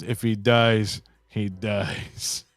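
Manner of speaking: low, cold Drago line from Rocky Four; Drago impression. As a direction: deliver it like a cold Russian boxer. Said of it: "some Drago shit, bro, from Rocky Four".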